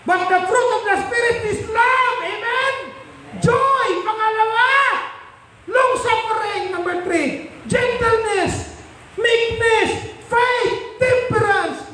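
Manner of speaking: high, ringing proclamation of scripture; impassioned verse, pitched high; full-force preaching. Acted. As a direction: proclaim each virtue from the pulpit.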